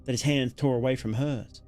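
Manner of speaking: ordinary talk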